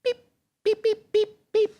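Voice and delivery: high chick peeps; small, high-pitched peeps; chick peeping. Pretend. Leader shows children how chicks peep, high peeping. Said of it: "everybody peep".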